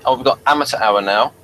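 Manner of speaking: ordinary delivery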